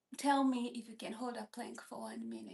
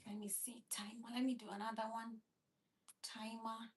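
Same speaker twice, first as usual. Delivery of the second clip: whispering; 2 semitones lower, 9 dB quieter, faster than usual. Real, not acted. Whispering to phone timer.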